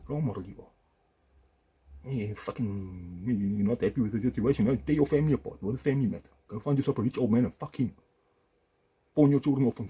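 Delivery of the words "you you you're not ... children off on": loud, higher tirade; angry rant. Real, not acted. Husband ranting at his ex-wife, loud furious tirade. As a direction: build the accusations in rage.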